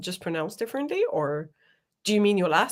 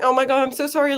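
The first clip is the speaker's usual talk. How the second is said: ordinary talk, nothing marked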